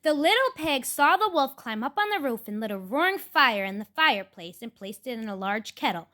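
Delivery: ordinary delivery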